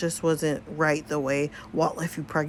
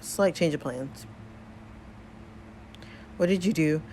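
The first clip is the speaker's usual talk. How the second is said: ordinary delivery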